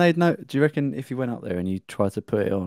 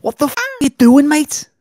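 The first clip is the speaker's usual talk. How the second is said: much higher, louder furious Scouse yell; angry Scouse shout; 9 dB louder than usual. Acted. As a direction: yell at the snail thrower in Scouse. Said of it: "imagine someone threw a snail at your car".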